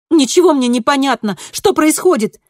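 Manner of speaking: high, loud, exasperated protest; agitated outburst. A narrator's reading. Kristina demanding answers from her double, agitated outburst. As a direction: raise your voice in baffled panic.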